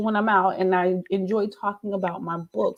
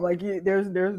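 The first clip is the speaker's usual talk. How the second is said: ordinary talk, nothing marked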